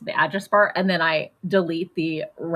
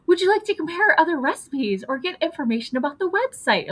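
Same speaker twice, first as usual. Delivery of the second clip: chatbot voice; 10 semitones higher than usual. Retold or imitated. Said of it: "but it was like".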